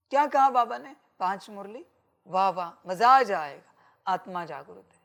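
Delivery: ordinary talk, nothing marked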